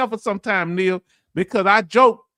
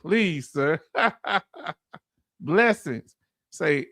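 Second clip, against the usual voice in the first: normal talking voice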